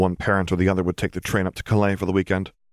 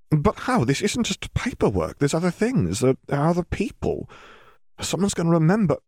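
lightly voiced worried mother; 10 semitones higher than usual. Worried parent voiced in story.

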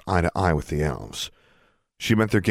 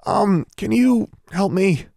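much higher, louder, slow plea; awkward plea; 5 dB louder, slower than usual. Acted. Trapped girl asks elves for help, high awkward plea. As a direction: stretch out the request for help. Calling for help after grimacing.

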